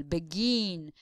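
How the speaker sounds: begin, drawing out the second syllable; stretched last syllable. Shown as a demonstration. Teacher demonstrating which syllable extends, begin drawn out at the end. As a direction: hold the GIN of begin long. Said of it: "extend the last syllable".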